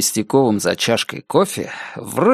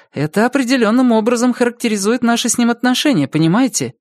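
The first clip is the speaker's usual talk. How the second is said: somewhat higher woman's voice, candid; lightly voiced frank ex-wife; 10 semitones higher than usual. Ex-wife frankly sizing up Igor to police, lightly higher voice. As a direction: lightly put on a higher voice, owning up.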